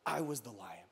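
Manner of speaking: very low, hushed revelation; large, deep voice. A narrator's reading. Lion revealing himself to the boy, deep and quiet. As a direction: let it drop deep and soft.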